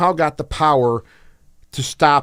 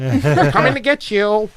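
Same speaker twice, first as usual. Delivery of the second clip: higher, slow spooky taunt through a laugh; mock horror taunt; 6 semitones higher, slower than usual. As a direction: tease them like a campy ghost story.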